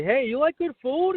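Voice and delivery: high-pitched, chirpy cold-call opener; acted-out eager salesman; retold sales pitch. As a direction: pitch it high, like greeting salon customers. Acting out salon cold-call pitch.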